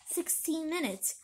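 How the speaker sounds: usual speaking voice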